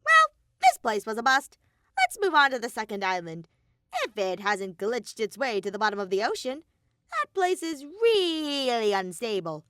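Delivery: high, loud cartoon bear voice; Monokuma voice.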